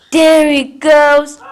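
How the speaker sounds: loud happy cheer; excited. Real, not acted. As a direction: cheer the dog on. Excited at the dog.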